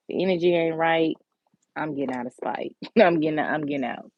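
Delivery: ordinary talk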